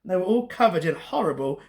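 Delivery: usual speaking voice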